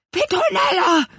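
high, loud little creature voice; urgently hiss-call the name from behind a hedge. A narrator's reading. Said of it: "zischelte es plötzlich hinter der Brombeerhecke". (hohes, gezischeltes Rufen)

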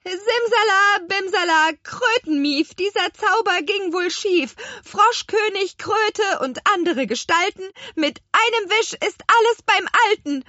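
high, loud chanted counter-spell; spell incantation. A narrator's reading. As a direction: cry out magic words urgently. Hexe ruft den Gegenzauber. (hoch gerufener Zauberspruch)

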